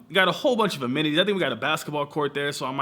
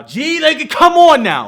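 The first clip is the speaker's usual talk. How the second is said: much higher and louder, come on now flung out; exasperated cry; 14 semitones higher, 16 dB louder, slower than usual. Real, not acted. Vlogger pleading with viewers about the grind, loud exasperated cry. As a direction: throw your hands up at the listener.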